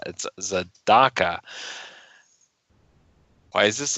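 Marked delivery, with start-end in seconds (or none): 0.1-1.4 Tzedakah as tze-DAH-kah, slow and falling; stress on the Dalet. Shown as a demonstration. Husband repeating the correction, Tzedakah stressed slowly on DAH. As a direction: punch the middle DAH of Tzedakah, taking it slowly. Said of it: "the emphasis is on the Dalet".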